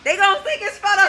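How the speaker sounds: loud, high-pitched holler; big, boastful yell; excited hype shout. Real, not acted. Excited crew hyping the shoot.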